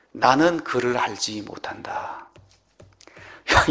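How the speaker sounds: ordinary talk, nothing marked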